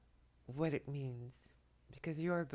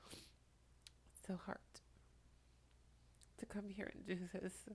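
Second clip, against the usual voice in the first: tearful; 5 semitones higher, 10 dB quieter than usual. Real, not acted. Channeling while crying, overwhelmed.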